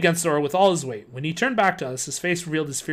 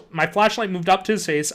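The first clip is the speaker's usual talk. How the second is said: usual speaking voice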